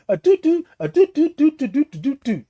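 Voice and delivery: high chirpy toot toot tones; keypad tones. Retold or imitated. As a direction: tap out a code in high beeps.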